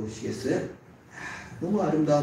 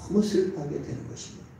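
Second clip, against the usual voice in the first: normal talking voice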